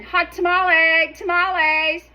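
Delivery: high, loud street-seller cry of hot tamales; tamale vendor call. Retold or imitated. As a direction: call it out like the tamale man hawking. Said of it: "he says it a lot better than I do".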